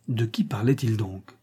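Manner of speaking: plain reading voice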